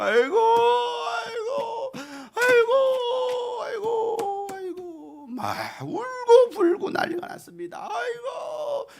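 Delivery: long, high drawn-out wails of grief; mourning wail. Retold or imitated. Preacher acting out mourners at a house of death, drawn-out high wailing. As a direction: wail aigo like mourners at a funeral. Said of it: "초상집 분위기 어떻습니까?".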